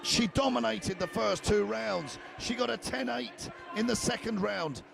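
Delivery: energetic live fight call; play-by-play commentary. Real, not acted.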